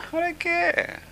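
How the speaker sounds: ordinary delivery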